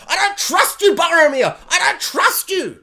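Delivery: high, loud accusing yell; mock distrustful shout. A hobbit yelling at Boromir over the ring, mock shout. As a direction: shout your distrust at a friend. Quoting Lord of the Rings scene.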